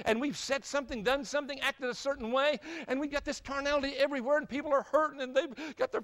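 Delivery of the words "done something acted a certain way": ordinary talk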